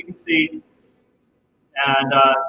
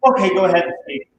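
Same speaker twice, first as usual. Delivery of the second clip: normal talking voice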